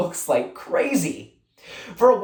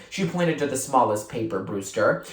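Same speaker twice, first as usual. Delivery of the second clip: usual speaking voice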